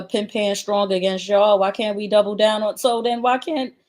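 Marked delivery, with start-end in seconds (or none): none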